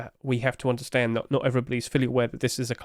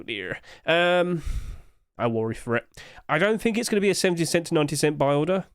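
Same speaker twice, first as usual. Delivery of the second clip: normal talking voice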